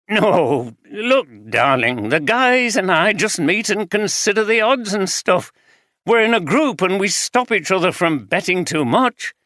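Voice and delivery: husband playing down his gambling, defensive. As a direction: talk it down as harmless. Husband laughing it off.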